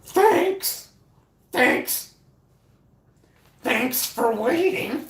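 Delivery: loud high-pitched mocking thanks. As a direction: squeal a fake polite thank you. Mocking support agent's thanks.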